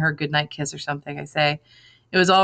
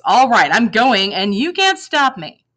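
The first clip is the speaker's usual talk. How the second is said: slow, louder, defiant declaration; angry defiance; 5 semitones higher, 6 dB louder, slower than usual. A narrator's reading. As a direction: dig in your heels angrily. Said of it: "Anger flushes my face".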